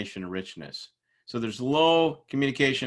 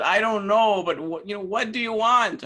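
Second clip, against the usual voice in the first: exasperated demand; 10 semitones higher, 5 dB louder than usual. Retold or imitated. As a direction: demand he finally say what he wants. Fed-up boss demands answer.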